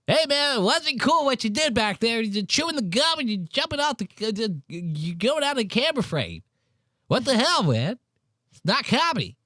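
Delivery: a hammed-up Mark leaving an angry message; mock angry voicemail. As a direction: leave a whiny scolding voicemail. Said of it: "Imagine getting an angry voicemail from Mark".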